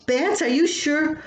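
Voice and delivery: high alarmed question; suddenly scared, needing reassurance; alarmed surprise. Retold or imitated. Wife realising bats are overhead.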